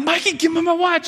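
put-on high-pitched child demanding his toy back; kid demanding. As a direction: beg like a little boy on the verge of tears. Kid demanding his stolen watch.

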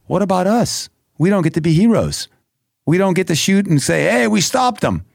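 high-pitched, aggrieved objection; indignant protest. Retold or imitated. Acted protest to God in prayer.

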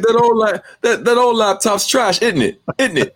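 high-pitched mock English accent; bad English accent. Bougie MacBook owner hating on kids' old laptops, bad English accent. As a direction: put on a high, hammed-up English voice. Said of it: "speaking in a bad Lon-, English accent".

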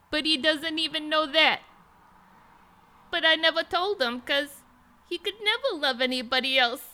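high, loud soap-opera cashier confessing love; lovestruck cashier. Acted. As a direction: gush it like a lovesick girl. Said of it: "chimes in with the final line".

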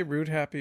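"Route" sounds like "root"; root pronunciation. Shown as a demonstration. Founder gives own way, "Route" as root. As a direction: say "Route" with an oo vowel. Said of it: "I say Route Happy".

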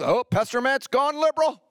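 hammed-up gasp of a church critic; panicky churchgoer. Pastor mimicking a church member accusing him, mock alarm.

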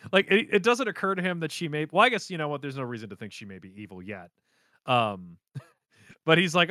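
ordinary delivery

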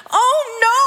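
high dramatic groan of dismay; mock dismay. Mother discovering her child may lie, mock dismay. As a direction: play up the dread, high.

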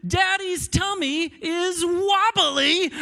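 imitating a little kid's high, loud holler; high-pitched mock alarm, drawn out at the end; top-of-lungs yell. Daughter warning everyone about Daddy's tummy, yelling at top of lungs. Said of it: "yells at the top of her lungs".

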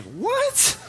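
very high squeaked mock "what"; comic leap up into an incredulous squeal; shocked disbelief. Student learning the teachers plotted against him, high-pitched shock. Shocked reaction to friend's news.

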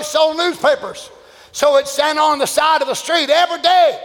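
normal talking voice

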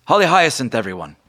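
high, loud introduction; Margot's announcement. Acted. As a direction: present her to the room. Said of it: "Margot Bingo stands up and says".

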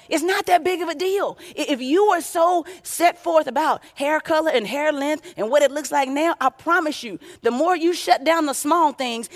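fervent sermon delivery; preaching. Real, not acted. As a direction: press the point home to the congregation. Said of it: "preaching with rising force".

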